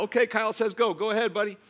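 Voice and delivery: lightly acted parent's instruction; instructing a child. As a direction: encourage a young kid to get moving. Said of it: "She instructs Kyle".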